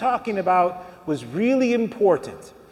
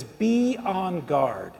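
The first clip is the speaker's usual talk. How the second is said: very slow and softer, word by word; solemn quoted warning; 2 semitones lower, 4 dB quieter, much slower than usual. Retold or imitated. Preacher quotes Jesus warning the crowd, slow and solemn. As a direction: draw out each word as a grave warning.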